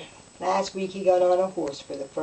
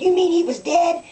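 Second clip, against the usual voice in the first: shocked; 11 semitones higher, 6 dB louder than usual. Retold or imitated. Shocked reply to mother's date story.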